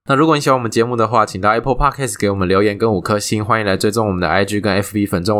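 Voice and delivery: rattled off fast without pausing; all in one breath. Real, not acted. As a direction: rush the outro in one go. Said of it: "一口气，一口气念完。". (一口气快速念完)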